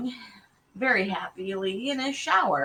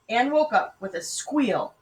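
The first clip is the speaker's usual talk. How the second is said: plain reading voice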